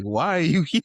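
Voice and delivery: high, loud startled why are you here; startled question. Retold or imitated. Host retelling the guest's sudden appearance, high startled question. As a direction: caught off guard in an undershirt.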